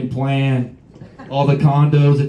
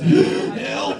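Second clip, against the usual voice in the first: fake heart attack cry; 12 semitones higher than usual. Comic faking a heart attack, gasping breathy cry. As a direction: gasp then a breathy high cry for help. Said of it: "You wanna see a fucking cardiac arrest?".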